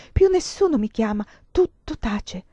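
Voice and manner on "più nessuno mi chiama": ordinary delivery, nothing marked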